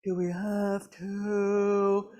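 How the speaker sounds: slow drawn-out whine; a hint of sulky student; whining students. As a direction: whine like reluctant teens. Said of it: "you hear the".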